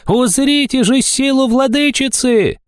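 squealing fanatic's proclamation, very high; shrill cry. A narrator's reading. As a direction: screech it out slowly like a crazed herald. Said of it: "визгливо закричал кто-то издалека". (визгливо, пронзительно, нараспев)

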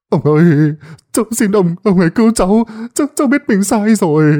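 cling to him and plead; desperate pleading. Acted. Luân on his knees begging the sorcerer, high and desperate. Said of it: "quỳ xuống đất ôm chặt lấy chân ... mà vái".

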